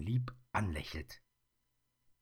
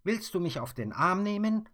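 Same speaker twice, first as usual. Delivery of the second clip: put-on much higher, louder indignant girl; grumbling; 14 semitones higher, 8 dB louder than usual. Mia grumbles at the teasing bear, high and loud. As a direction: snap at the bear, offended. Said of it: "meckert sie".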